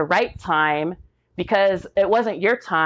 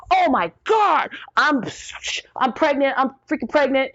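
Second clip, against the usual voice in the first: excited disbelief; 7 semitones higher than usual. Retold or imitated. Telling her husband, high shocked excitement. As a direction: squeal it out, stunned with joy. Shocked joy at pregnancy test.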